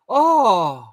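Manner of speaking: high, loud "ohh" of amazement; drawn-out gasp of wonder, retold; delighted "oh". Retells hearing first honk.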